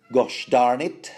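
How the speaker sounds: lightly acted mother's curse, slow and loud; motherly mild swearing. Podcaster as mother swearing around kids, mock exasperation. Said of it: "The sort of swearing that mothers would".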